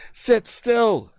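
very high called order; shouted. A narrator's reading. Tom shouting to the woman over hoofbeats. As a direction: shout it over the rattling wagon. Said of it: "he shouted".

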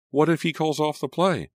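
anxious outburst, pitch way up; alarmed. A narrator's reading. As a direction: protest with rising panic. Heroine alarmed at losing play.

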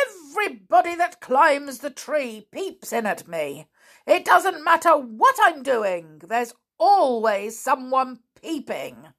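high, indignant little man; cross pixie. Acted. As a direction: grumble like a put-upon pixie. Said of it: "the angry little man who looked like a pixie".

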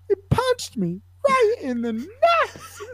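imitating an upset kid in a squeaky, slow falsetto; mock wail, way up an octave; high-pitched cartoon voice. Hurt kid complaining to the sideline medic, imitated in a cartoon-high voice. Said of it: "cartoon character is hitting this octave".

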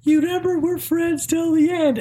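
very high, sweet little doll voice; Chucky. Retold or imitated. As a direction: plead in a sugary toy squeak. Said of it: "go to the sweet voice".

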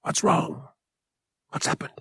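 soft, concerned whisper; hushed and breath-only. A narrator's reading. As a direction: whisper a worried question. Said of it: "Han whispered".

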